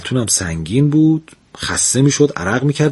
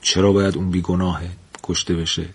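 softer and lower, talking to himself; 6 semitones lower, 5 dB quieter than usual. A narrator's reading. The physician reasoning to himself, quiet. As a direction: murmur it as a private thought. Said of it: "با خودش گفت".

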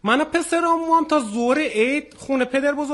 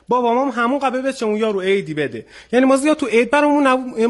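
ordinary talk

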